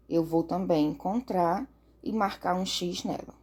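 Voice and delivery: normal talking voice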